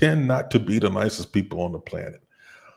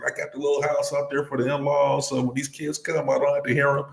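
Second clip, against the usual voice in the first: lightly imitated host, pitched higher; quoting a friend; 6 semitones higher than usual. Friend explaining his in-law house, lightly imitated. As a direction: relay his boast in a higher voice. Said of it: "He was like".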